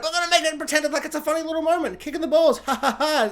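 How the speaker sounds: high, quick pitch breaking into a fake laugh; forced jokey laughter. Studio boss passing tragedy off as funny, forced jokey laugh. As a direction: laugh it off too eagerly.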